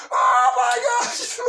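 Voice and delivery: high, slow drawn-out yell; hammed-up alarm; panicked shout. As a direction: wail it in shock.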